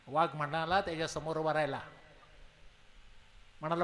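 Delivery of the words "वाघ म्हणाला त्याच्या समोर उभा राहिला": ordinary delivery, nothing marked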